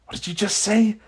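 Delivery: confused, voice lifted high; slight confusion. A narrator's reading. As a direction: ask it as if thrown slightly. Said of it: "Thawne asks in slight confusion".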